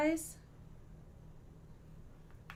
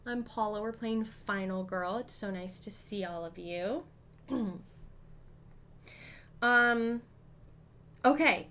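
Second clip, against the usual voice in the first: ordinary delivery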